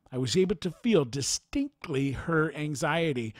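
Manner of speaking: ordinary delivery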